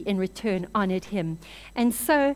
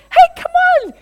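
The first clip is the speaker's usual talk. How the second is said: very high, loud impatient yell; mock scolding shout; 13 dB louder than usual. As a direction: berate her at the top of your voice. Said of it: "he just gives her a mouthful".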